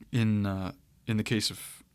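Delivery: ordinary talk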